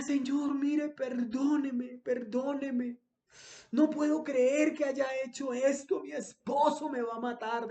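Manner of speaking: preacher voicing a frightened young wife begging forgiveness; tearful pleading. A narrator's reading. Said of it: "al volante que lloraba". (súplica llorosa, algo más aguda)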